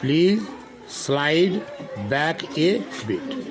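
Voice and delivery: each word set apart with pauses, the last words very slow. Shown as a demonstration. Teacher breaks the sentence into single words for children. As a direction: spell it out slowly for the class, one word at a time. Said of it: "इसको बोलते हैं".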